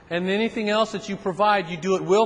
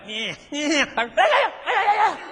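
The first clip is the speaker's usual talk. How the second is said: mocking little guy; 10 semitones higher than usual. Little guy jeering during police stop.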